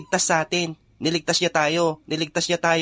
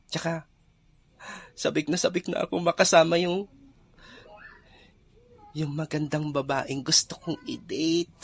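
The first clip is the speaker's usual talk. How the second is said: slow, softened daydreaming; slow and soft, lost in fantasy; dreamy longing; 6 dB quieter than usual. Acted. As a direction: drift off into a lovestruck daydream. Daydreams aloud about his dream date.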